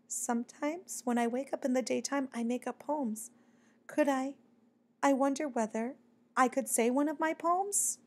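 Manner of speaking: timid request. A narrator's reading. Shy bat asks the mockingbird to hear his poem, soft and hesitant. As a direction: venture it softly, with shy pauses.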